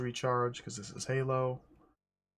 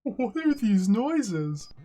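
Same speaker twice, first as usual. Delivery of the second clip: mock spooky puzzlement; 9 semitones higher, 6 dB louder, slower than usual. Puzzled by strange game sounds.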